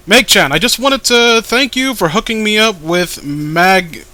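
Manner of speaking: loud, emphatic character read; voiced character. Comic dub actor reading a character's thanks, loud. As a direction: belt out the goofy character's thanks.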